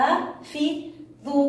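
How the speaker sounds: slow, segmented أحافظ; split into syllables. Shown as a demonstration. Teacher recapping the word for pupils, syllable by syllable. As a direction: sound out each syllable of أحافظ separately. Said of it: "ونريد أيضًا أن نقطعها".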